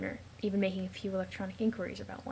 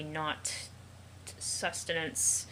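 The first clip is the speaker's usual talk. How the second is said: stretched out slowly, syllable by syllable; 4 semitones lower, 5 dB quieter, much slower than usual. Acted. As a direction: spell out sustenance slowly like to a child. Drawn out, correcting the pet.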